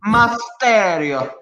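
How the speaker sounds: put-on slow, high Italian-accented name. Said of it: "using your Italian accent".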